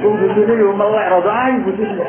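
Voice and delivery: high, loud and laughing; excited joking. Acted. Preacher teasing the congregation about a pretty wife, high and loud with laughter. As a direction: whip the crowd up with a joke.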